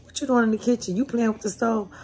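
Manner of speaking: mother calling up to the kitchen; calling the daughter. Retold or imitated. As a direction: call her name up to the kitchen.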